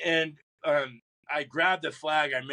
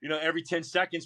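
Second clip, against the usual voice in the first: ordinary delivery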